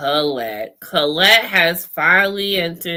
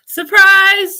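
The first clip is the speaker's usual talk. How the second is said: teasing surprise; 12 semitones higher, 8 dB louder than usual. Real, not acted. Teasing reveal to co-host.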